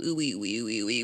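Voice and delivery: low, soft string of wees; mock dithering noises. Client stalling over the week to book, mock dithering noises. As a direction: dither in a low little siren. Acting out her dilemma over the appointment.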